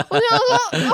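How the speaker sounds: real shocked laughter; laughing. Real, not acted. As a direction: crack up at the punchline.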